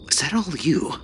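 high, soft questioning officer. A narrator's reading. Officer asks runner about screams, softly questioning.

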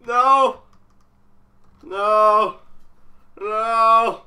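loud, separate cries of no; frightened refusal. Real, not acted. Player refusing to go on, loud frightened cries. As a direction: protest loudly in alarm.

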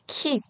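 키 short and high with a puff; aspirated 키. Shown as a demonstration. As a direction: release the k of 키 with air. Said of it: "이렇게 읽으시고요". (거센소리로 짧고 높게 키)

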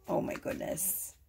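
breathless whispered gasp of awe; a hushed, real oh my goodness; awed whisper. Real, not acted. Reacting to a drawn card.

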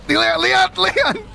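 high, urgent repeated name; alarmed calling. Real, not acted. Player warning Leon in the game, high panicked cry. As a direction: call the name with rising alarm.

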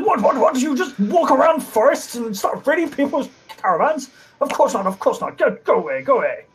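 high, startled, indignant actor voice; huffy traveling player shooing them off; flustered actor. Acted. Actor shooing strangers from his wagon, high and indignant.

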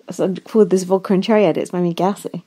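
usual speaking voice

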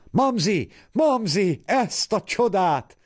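high, loud call at the window; excited calling. Acted. Woman calling the madam to come see, high and loud. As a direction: bang on the glass and call her out.

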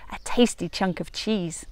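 plain reading voice

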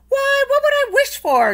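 very high Irish question; Jamie asking. A narrator's reading. Jamie asking the leprechaun, very high voice. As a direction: ask in disbelief. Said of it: "Jamie asked".

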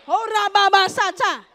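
loud, drawn-out burst of tongues; praying in tongues. Real, not acted. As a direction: burst into slow, forceful prayer syllables. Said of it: "I'm finna pray".

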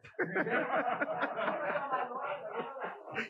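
a big high burst of laughter. Real, not acted. Laughing at his own line.

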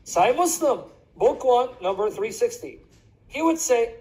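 usual speaking voice

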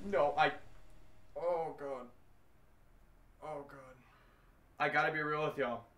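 ordinary delivery

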